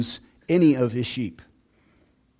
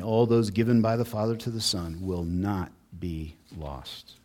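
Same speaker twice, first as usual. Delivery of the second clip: normal talking voice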